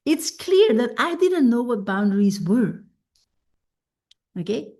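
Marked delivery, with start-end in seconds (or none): none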